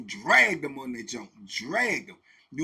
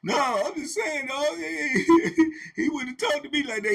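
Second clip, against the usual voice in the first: higher voice broken by laughs; laughing while talking; 9 semitones higher, 5 dB louder than usual. Retold or imitated. Inmate laughing at another inmate, words broken by laughs. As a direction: giggle through the words. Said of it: "dudes was laughing and joking".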